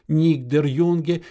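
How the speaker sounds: ordinary delivery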